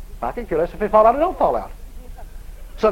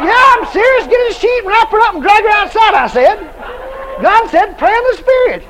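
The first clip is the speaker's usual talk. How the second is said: laughing insistence; 9 semitones higher, 6 dB louder than usual. Retold or imitated. Laughing while insisting on the plan.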